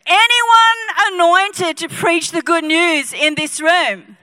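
loud, high, drawn-out rallying call; rousing shout. Real, not acted. Preacher rousing congregation after lousy response, loud call. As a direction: stretch it out and fire up the room.

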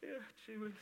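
hammed-up tearful concern; mock sympathy. Preacher mocking a pitying listener, mock sobbing. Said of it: "not go, "Oh, hang on ... get my violin out".

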